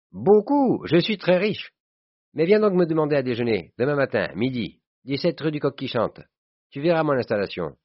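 put-on high, exuberant boasting from a rich old pal; brag, then warmly press your invitation; boastful jovial friend. (copain riche et vantard, voix haute et enjouée)

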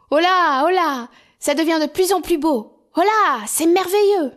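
appels forts et très aigus; s'émerveille à pleine voix; ravi, crié. Acted. Hans hèle ses frères, ravi. (Hans crie sa joie à ses frères)

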